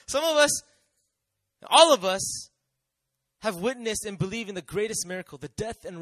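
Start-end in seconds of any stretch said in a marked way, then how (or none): 0.1-2.5 rising preaching shout. Real, not acted. Building preaching climax.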